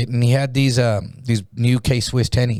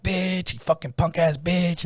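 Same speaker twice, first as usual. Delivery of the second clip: high, drawn-out jeer without volume; mock jeering yell; 5 semitones higher, 5 dB quieter, slower than usual. Everyone in the dorm to the slapped man, jeering. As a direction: imitate a crowd's taunt, held back. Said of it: "yelling shit at him".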